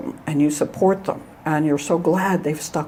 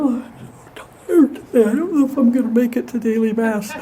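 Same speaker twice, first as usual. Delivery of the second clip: higher, self-pitying whine; mock whiny complaint; 5 semitones higher than usual. Priest mocking his own lazy excuse, whiny. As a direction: moan about being tired, tongue in cheek. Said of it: "sitting around saying".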